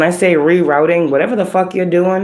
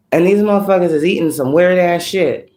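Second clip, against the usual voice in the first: normal talking voice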